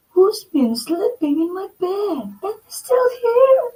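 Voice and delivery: very high cub scream, rising; Baby Bear screaming. Acted. Baby Bear finding Goldilocks in bed, high scream. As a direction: shriek that she's still here. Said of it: "Baby Bear screamed".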